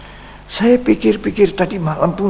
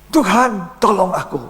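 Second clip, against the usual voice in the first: high, urgent plea as he sinks; panicked cry for help; 6 semitones higher than usual. Retold or imitated. Sinking Peter crying out to the Lord, high and urgent. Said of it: "dia kaget, dia bilang".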